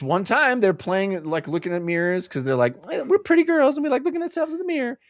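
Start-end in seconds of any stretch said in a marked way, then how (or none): 2.9-5.0 high, fast, girly mockery; mock vain girls. Host mocking the girls at the mirror, high and fast. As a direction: ham up the preening girls.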